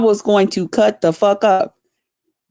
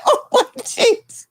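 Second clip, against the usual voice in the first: mocking voice; 13 semitones higher, slower than usual. Mocking the other woman's voice.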